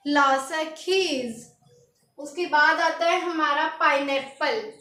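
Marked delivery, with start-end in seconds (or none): 0.1-1.5 ला शखीज drawn out slowly; slow French pronunciation. Shown as a demonstration. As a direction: say ला शखीज slowly for students to copy. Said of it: "क्या पढ़ेंगे?".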